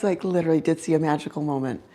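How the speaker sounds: ordinary talk, nothing marked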